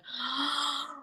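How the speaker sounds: long lovestruck gasp; smitten gasp. Acted. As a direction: gasp as if love just walked past. Acts out falling in love.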